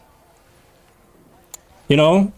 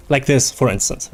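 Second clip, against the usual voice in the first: ordinary talk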